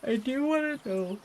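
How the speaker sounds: words stretched by a yawn. Real, not acted. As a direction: yawn through the line. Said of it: "Gosh, I'm so sorry. I'm yawning".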